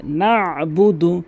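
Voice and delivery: chanted না'বুদু with a squeezed throat stop after না; pressed ayn. Shown as a demonstration. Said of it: "এখানে চাপ দিয়ে". (না'বুদু-তে আইনে চাপ দিয়ে)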